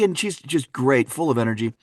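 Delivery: ordinary talk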